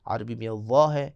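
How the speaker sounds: ordinary talk, nothing marked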